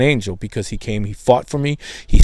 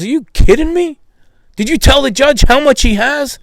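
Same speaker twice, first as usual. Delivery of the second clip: much higher, louder incredulous outburst; shocked disbelief; 13 semitones higher, 4 dB louder than usual. Retold or imitated. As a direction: sound floored by the news.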